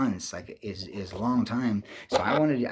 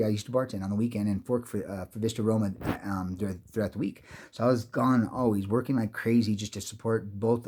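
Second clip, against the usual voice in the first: usual speaking voice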